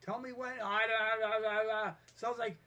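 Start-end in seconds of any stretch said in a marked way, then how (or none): 0.0-0.6 higher, frustrated demand; mild mock yell, higher; retold yelling. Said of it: "here I am yelling at him". 0.6-1.9 mock angry babble. Mock rant noise.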